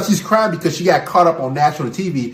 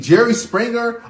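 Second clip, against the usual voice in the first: ordinary delivery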